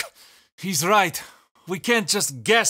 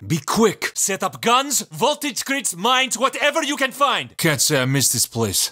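ordinary talk, nothing marked

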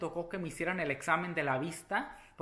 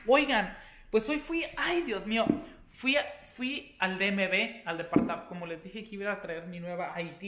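usual speaking voice

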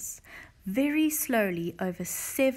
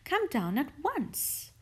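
high, drawn-out invitation; eager cry; 7 semitones higher, slower than usual. A narrator's reading. Missus Silver calling Mister Hoppy downstairs, eagerly. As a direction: urge him eagerly, pitched up. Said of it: "Missus Silver cried".

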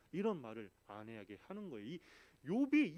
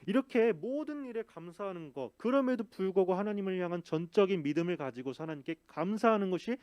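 full-force preaching; 3 semitones higher, 7 dB louder than usual. Real, not acted.

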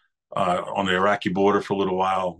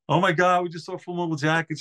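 high, mock-tearful sister voice; imitating his sobbing sister, pitched up; mock crying sister; 8 semitones higher than usual. Sister home from the movie, in a high hammed-up tearful voice. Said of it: "She's crying".

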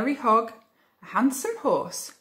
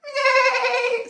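loud, very high whinny; drawn-out high horse call; horse neigh; 6 dB louder than usual. A narrator's reading. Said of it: "said the horse".

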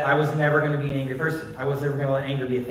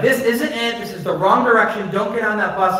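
higher-pitched, mildly exasperated warning; exasperated objection; 6 semitones higher, 4 dB louder than usual. Retold or imitated. Husband warning against boarding the bus, mildly exasperated.

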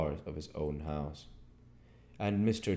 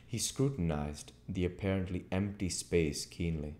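ordinary delivery

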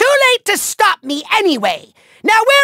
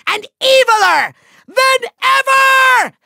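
high villain shout, building; grandiose threat; 7 semitones higher than usual. Acted. Villain boasting of his next creation, grandiose shout. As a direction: proclaim it bigger with each phrase.

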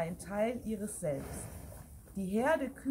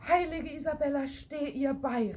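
excited call; 5 semitones higher, 4 dB louder than usual. Acted. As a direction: cheer her on, stirred up. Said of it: "riefen mehrere Kühe aufgeregt".

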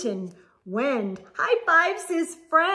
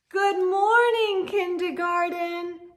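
high, loud bright hello to the class; cheerful greeting; 5 semitones higher, 4 dB louder than usual. A narrator's reading. The teacher welcoming her new kindergarten class, cheerfully. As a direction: greet the whole room with delight. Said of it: "says Miss Bindergarten".

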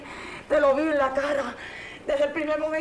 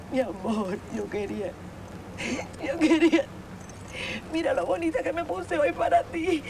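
grieving farewell; 4 dB quieter than usual. Acted. Widow says goodbye to her husband at the coffin. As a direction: mourn him softly at the coffin.

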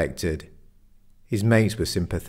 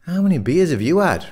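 high, loud, teasing challenge; incredulous jibe; 10 semitones higher, 6 dB louder than usual. A narrator's reading. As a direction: throw it back at a mate, pitched up. Friends arguing over meat at pub.

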